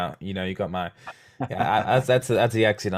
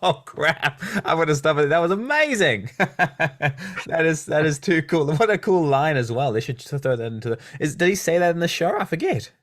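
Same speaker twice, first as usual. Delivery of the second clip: excited; 7 semitones higher than usual. Real, not acted. Host thrilled by the line.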